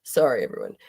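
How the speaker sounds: whispered through tears; tearful whisper. Real, not acted. Apologizing to her viewers while crying, whispered. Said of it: "I told you I was going to cry".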